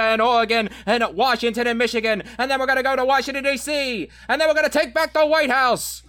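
rallying cry building from firm to high, loud shouting; Howard Dean impression. Guest challenged to do Kennedy doing Dean, building impression to a scream. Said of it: "doing an impression of Howard Dean".

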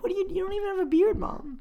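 high, soft, quick aside; mock bafflement. Child to mom stroking her chin at paintings, mock-baffled. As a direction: question it in a small high voice.

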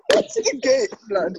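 high laughing voice. Real, not acted. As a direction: laugh through it. Laughing at own joke.

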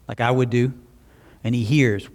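normal talking voice

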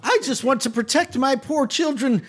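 high, plaintive put-on voice; lamenting matron. Matron lamenting quarantine to visitors, plaintive. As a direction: wail mildly about the burden, pitched high.

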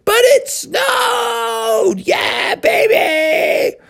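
very high, drawn-out whoop; ecstatic scream. Acted. Froggy defies his parents over the snow, screaming with joy. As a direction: shriek with unstoppable glee.

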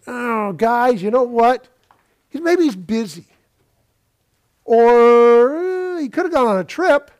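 teasing Elijah, feigning thoughtful suggestions; sarcastic. Acted. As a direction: needle them with fake sympathy. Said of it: "I don't know whether Elijah meant it sarcastically, but it sure came across that way".

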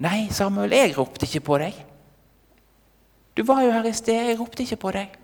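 lightly acted, high-pitched denial; mildly exasperated old priest; protesting priest. Priest denies calling, woken again.

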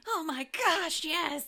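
high, loud, thrilled exclamation; excited outburst. Real, not acted. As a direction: burst out in delighted agreement.

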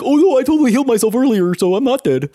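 silly character voice. Acted. Imagining himself explaining the healing later, silly character voice. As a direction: lift it up into a mock protesting character. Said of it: "I wish you sounded like that".

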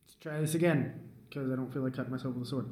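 ordinary talk

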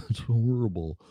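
usual speaking voice

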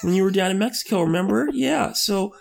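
put-on baby him like he's nine years old; dad soothing his grown son like a small child; talking to a little kid. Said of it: "began to talk to his son like his son is ten or nine years old".

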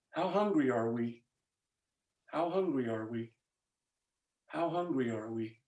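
soft, low, searching question to the congregation; solemn, hushed preacher's repetition; hushed appeal. Real, not acted. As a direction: drop low and quiet, letting each repeat sink in.

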